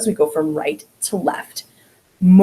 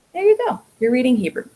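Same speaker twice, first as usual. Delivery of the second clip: ordinary talk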